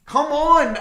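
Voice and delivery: high, loud exasperated shout; frustrated yell. Real, not acted.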